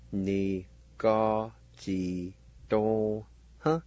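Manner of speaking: "Ni, ga, ji, dong, huh" with a gap between syllables; syllable-by-syllable pronunciation. Shown as a demonstration. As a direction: teach "Ni, ga, ji, dong, huh" one piece at a time. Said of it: "is pronounced like this".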